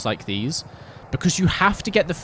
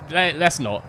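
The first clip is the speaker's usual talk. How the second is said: normal talking voice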